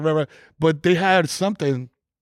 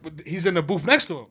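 ordinary talk, nothing marked

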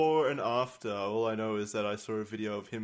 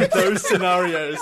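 higher and louder, laughing through it; laughing through a repeat; 6 semitones higher, 8 dB louder than usual. Retold or imitated. Repeating a line of the comment to friends while laughing. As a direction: say it again as the laugh takes over. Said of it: "I'll read it verbatim".

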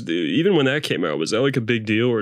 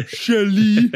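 very high, louder cartoon wail; Randy Marsh; 10 semitones higher, 5 dB louder than usual. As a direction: push the mock cry higher and louder. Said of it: "like, Randy Marsh energy".

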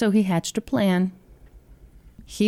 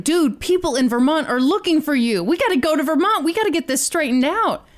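higher, urgent plea; urgent pleading; 7 semitones higher than usual. Retold or imitated. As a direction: press him to come home. James voiced urging Russell.

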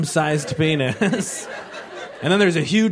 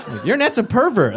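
ordinary delivery

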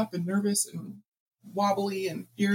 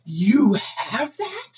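surprised; 5 semitones higher, 4 dB louder, much slower than usual. Retold or imitated. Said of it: "and he says".

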